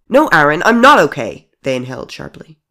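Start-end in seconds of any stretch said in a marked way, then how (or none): 0.1-1.5 much louder and higher, breaking loose; outburst. Acted. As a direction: finally let it burst out at the friend. Friend snaps when asked if okay.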